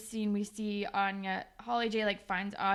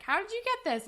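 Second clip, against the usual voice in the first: high-pitched mock surprise; imitated astonished question; 11 semitones higher, faster than usual. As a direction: ask it high, as if taken aback. Voices a girl questioning the returned ring.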